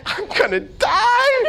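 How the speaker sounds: high, slow, hammed-up terrified wail; terrified husband wailing before skydiving; mock panic. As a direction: play up the panic like a doomed man. Voicing his own panic on plane.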